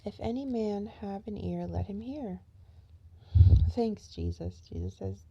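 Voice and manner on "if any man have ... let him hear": loud, declaiming bible verse; booming sermon reading; scripture reading. Acted. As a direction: proclaim it like a preacher. Reads scripture aloud.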